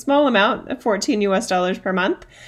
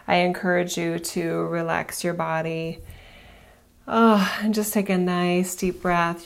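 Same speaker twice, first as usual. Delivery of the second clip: guided meditation. Acted. Leads a breathing meditation.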